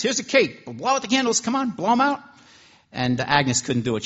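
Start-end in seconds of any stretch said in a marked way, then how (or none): 0.0-2.2 much higher and louder, eager cook; excited urging. Acted. Cook urging Agnes to blow out candles, high and loud. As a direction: cheer her on to blow the candles.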